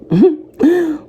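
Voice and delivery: a sudden high burst of laughter. Real, not acted. Laughing at her crazy thirty-fifth birthday, high laugh.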